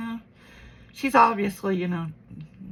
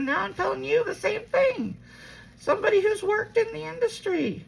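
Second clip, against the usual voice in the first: much higher, emphatic reproach; pointed retort; 12 semitones higher than usual. Retold or imitated. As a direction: throw her words back at her. Retells arguing with her mom.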